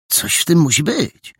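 intrigued, moderately high; pondering conclusion. A narrator's reading. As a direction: conclude there is a mystery here. First boy concludes, later echoed.

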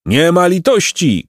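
mild high-pitched shout; crowd shout. A narrator's reading. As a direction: call it out pitilessly as a crowd. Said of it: "krzyknął tłum".

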